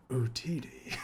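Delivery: low, quiet, menacing Darth Maul; sinister voice. Retold or imitated. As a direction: say it darkly, like a villain. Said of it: "in his very, like, sinister voice".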